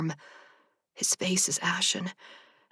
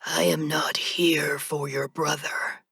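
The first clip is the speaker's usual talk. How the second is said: slow, strained, whispered monotone; ancient reaper whisper; slower than usual. A narrator's reading. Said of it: "he states".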